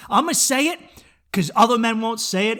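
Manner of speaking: usual speaking voice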